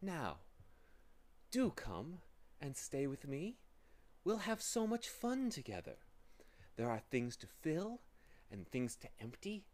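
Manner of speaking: lightly wheedling, coaxing villain; pleasing, deceitful voice. A narrator's reading. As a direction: lure them in with false sweetness. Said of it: "soft, pleasing, deceitful voice".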